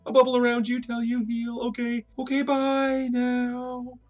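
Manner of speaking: high, strained singsong voice; falsely cheery high farewell, drawn out; awkward goodbye. Retold or imitated. Johnny awkwardly abandons injured man.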